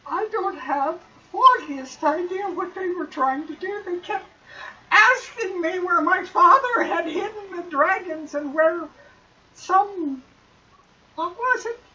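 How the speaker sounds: high, rising panicked rambling; frightened young man. Acted. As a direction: voice climbing into a cry. Floon voiced, frightened and confused.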